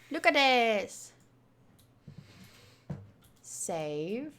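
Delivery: usual speaking voice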